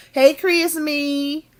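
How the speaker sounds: loud high hello to a viewer; calling out. Real, not acted. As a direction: shout out a chatter's name. Greeting a chatter by name.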